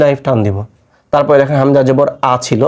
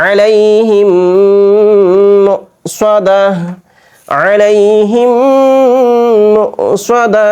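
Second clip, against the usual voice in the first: chanted আলাইহিম মুসাদা, pitched higher and louder; Quran recitation; 6 semitones higher, 3 dB louder, much slower than usual. Shown as a demonstration. Teacher reciting the verse for students to follow, raised chant. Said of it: "তাহলে কি পড়ছি বলেন তো".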